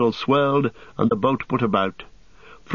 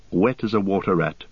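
ordinary delivery

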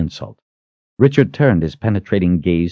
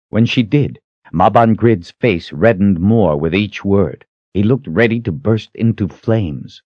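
ordinary delivery, nothing marked

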